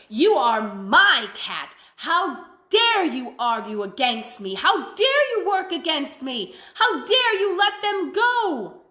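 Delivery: slow angry witch working up to a high shout. A narrator's reading. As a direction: build the witch's fury line by line. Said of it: "very angry at the cat".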